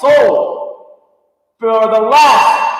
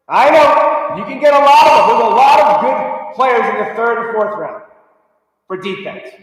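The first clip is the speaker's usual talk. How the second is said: usual speaking voice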